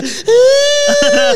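very high loud crying wail; loud high mock cry of a crying girlfriend; imitated wail. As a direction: wail like someone sobbing in panic. Mimics Isabel crying.

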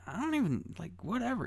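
put-on high, soft little-kid shrug; imitated indifferent toddler. Man imitating a two-year-old's answer, high and dismissive. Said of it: "And he's just like".

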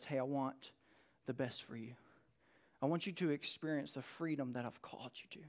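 put-on hushed and close, as if comforting a child; loving father's voice. Loving Father speaks to his child.

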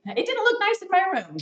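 high, whiny complaining customer; mock reviewer. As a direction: squeak it out like a picky shopper. Mocking a negative review.

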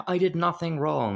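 mildly acted indignant denial; protesting daughter. Kidnapped daughter protesting her innocence, indignant. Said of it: "she's going".

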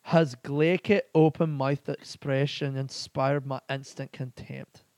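slow, careful attempt at Scottish; awful Scottish accent. Podcaster testing whether the Scottish accent is good, slowly. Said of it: "That was an awful Scottish accent".

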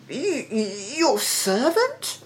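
high, stuttering disbelief; stunned stammer. A narrator's reading. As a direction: stammer it out, rising in shock. King stunned by the claim.

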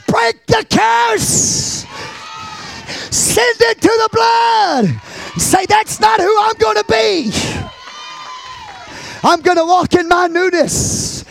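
very high, drawn-out shouts; shouted pulpit command; full-force preaching. Real, not acted. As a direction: hurl each phrase out as a battle cry.

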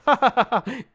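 high, loud acted laugh; staged hearty laugh. Russian reviewer laughing at his own threat, staged laugh. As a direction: laugh big in character.

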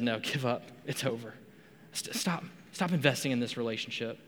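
soft, low, half-whispered voicing of friends saying give up; a quiet, mild mock of friends' doubtful urging; hushed retold advice. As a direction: friends quietly telling someone to quit their marriage, hushed.

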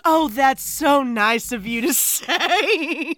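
drawn-out, exaggerated mock sweetness; slow, hammed-up impression with a wavering last word; mocking imitation. Co-host imitates the film's wife for laughs, mock-sweet. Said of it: "When she goes".

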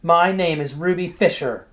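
wife declaring her name passionately to her husband; passionate declaration. A narrator's reading. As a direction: declare it with all her heart. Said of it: "she declared passionately to Clyde".

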